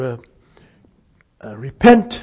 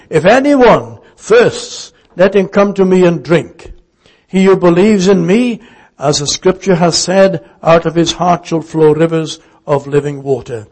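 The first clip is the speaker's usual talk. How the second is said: crying out; 4 dB louder than usual. Retold or imitated. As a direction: call it out to the whole crowd. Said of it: "cried out".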